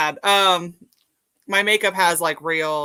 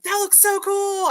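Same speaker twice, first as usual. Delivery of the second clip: quoted crew; 14 semitones higher than usual. Retold or imitated. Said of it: "they were like".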